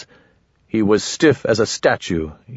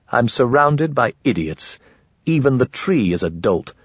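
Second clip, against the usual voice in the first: ordinary delivery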